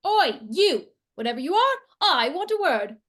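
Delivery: loud calling out; shouting. Acted. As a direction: bellow down the corridor. Calling out down corridor.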